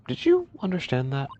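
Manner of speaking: usual speaking voice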